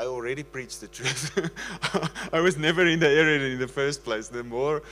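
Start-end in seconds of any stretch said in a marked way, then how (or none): 1.5-2.3 short amused laugh; real laugh. Real, not acted. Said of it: "I can't stop laughing". 2.3-4.8 laughing while talking. Real, not acted. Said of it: "I can't stop laughing".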